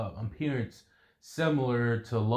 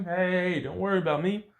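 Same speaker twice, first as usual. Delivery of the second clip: higher-pitched, brushing off the worry; breezy dismissal; 8 semitones higher than usual. Acted. Fighter tells worried partner to go ahead, breezy dismissal. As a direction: wave it off in a raised voice.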